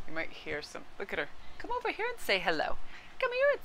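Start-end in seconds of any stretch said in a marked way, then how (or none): 1.6-3.6 high, sing-song call to the cat. Real, not acted. Calling her cat over in high baby talk. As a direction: coax the kitty over in a squeaky voice.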